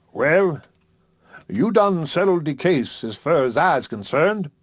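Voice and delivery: lightly voiced old preacher, slow and pleased; a hint of a satisfied country preacher; contented preacher. Haywood's voice, satisfied after supper.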